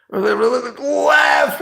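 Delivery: high cry of left; shout. Real, not acted. Choosing left in the game, high shout. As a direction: yell it out, way up high.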